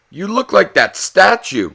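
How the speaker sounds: lightly voiced impressed friend; friend's voice. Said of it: "his friend said".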